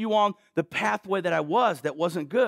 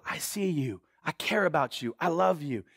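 tender whisper-soft Jesus; 4 semitones lower, 4 dB quieter than usual. Acted. Jesus speaking tenderly to leper.

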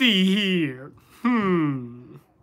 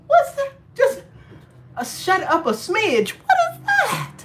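much higher, shrill disbelief; baffled; 13 semitones higher than usual. Real, not acted. Coworker baffled by "shut up a smidge", squealing disbelief.

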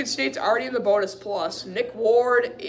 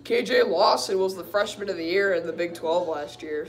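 usual speaking voice

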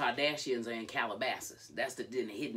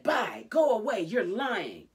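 loud, high re-enacted retort; angry dismissal; 8 semitones higher, 10 dB louder, slower than usual. Retelling Jade sending Christy away, angry and loud. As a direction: shout someone away.

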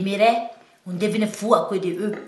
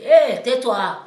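cri pour la faire taire; 6 semitones higher, 10 dB louder, much slower than usual. Retold or imitated. Sa famille lui dit de se taire.

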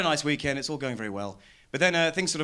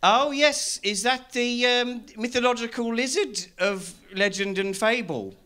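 bumbling local; 8 semitones higher than usual. Retold or imitated. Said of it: "conversations like".

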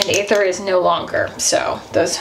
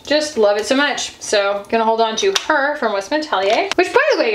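ordinary delivery

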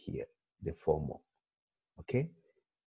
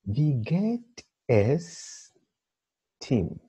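slow, higher "wie geht es"; drawn-out reading; 6 semitones higher, much slower than usual. Shown as a demonstration. Teacher reading the first exercise item slowly aloud to the class. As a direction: stretch each word out.

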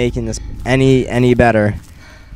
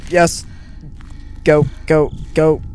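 ordinary delivery

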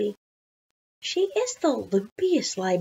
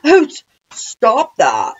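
loud, slow, mock-ticklish objection; giggling protest; 3 semitones higher, 8 dB louder, slower than usual. A narrator's reading. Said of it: "Missus Rupee giggled".

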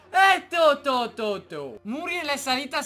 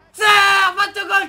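higher, louder cry of joy; excited goal shout; 6 semitones higher, 11 dB louder than usual. Real, not acted. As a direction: yell it as the ball goes in. Scoring a goal.

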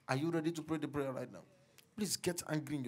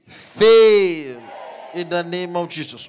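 full-force preaching; 22 dB louder, slower than usual. Acted. Pastor prophesying power over the congregation, shouting. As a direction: climb to a roaring climax. Commands satanic agenda to fail.

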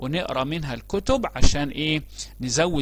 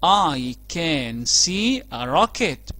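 slow model phrase; 4 dB louder, much slower than usual. Shown as a demonstration. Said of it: "كان عنوانها ايه؟".

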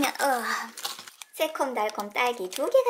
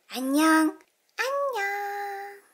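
slow, high little creature hello; high cutesy hello; cute toy voice; 7 semitones higher, 4 dB louder, much slower than usual. Pretend. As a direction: stretch a tiny squeaky greeting.

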